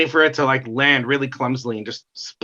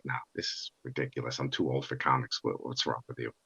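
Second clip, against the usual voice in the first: very low, hushed mock refusal; dismissive; 12 semitones lower, 15 dB quieter than usual. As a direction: brush it off under your breath, very low. Retelling own dismissive reply.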